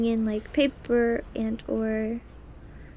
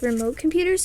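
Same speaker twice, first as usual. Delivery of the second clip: ordinary talk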